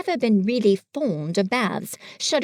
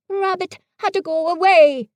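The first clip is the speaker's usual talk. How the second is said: higher, simple bear voice; Pooh, innocent and fumbling; 8 semitones higher, 4 dB louder than usual. A narrator's reading. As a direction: play a guileless little bear. Pooh speaking in the story.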